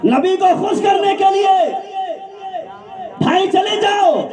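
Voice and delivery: preacher calling out to the congregation; loud call-out. Real, not acted. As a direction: lift the voice so the crowd repeats it. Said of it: "زور سے بولنا تھا".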